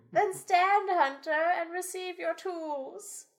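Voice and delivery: high ceremonial elder voice; town elder voice. Acted. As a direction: proclaim it like the old elder. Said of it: "The elder addresses the town".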